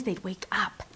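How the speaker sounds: put-on hushed whisper, as if kids sleep nearby. Crafter recalling napping kids, whispered. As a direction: breathe it so nobody wakes. Kids asleep across the hall.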